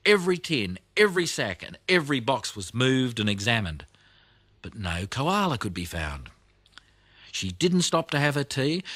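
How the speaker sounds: ordinary delivery, nothing marked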